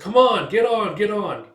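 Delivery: high, loud invitation; hearty urging. Retold or imitated. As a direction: wave him aboard with insistence. Old man urges him aboard.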